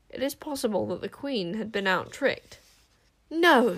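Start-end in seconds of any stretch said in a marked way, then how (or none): 3.3-3.8 loud, high, sharp refusal. A narrator's reading. Queen refusing the attack, sharp. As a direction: cut in sharply. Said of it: "she said sharply".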